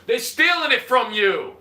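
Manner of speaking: loud, slow, emphatic accusation. Real, not acted. Ranting to city taxpayers about government theft, indignantly loud.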